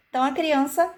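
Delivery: normal talking voice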